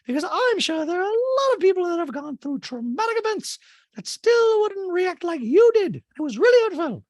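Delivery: very high, loud caricature voice; Dorit. Retold or imitated. Recapper mimicking a castmate answering an apology, high and loud. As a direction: blare it in a piercing falsetto. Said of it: "Dude's like".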